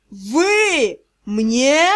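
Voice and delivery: loud roaring demand. Acted. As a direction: roar it out in outrage. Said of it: "взревел демон". (взревел)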